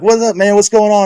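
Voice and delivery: asking the hurt coworker what is going on; alarmed. Retold or imitated. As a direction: sound worried and confused. Alarmed retold question.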